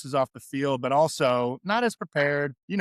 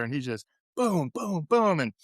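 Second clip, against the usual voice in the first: ordinary talk, nothing marked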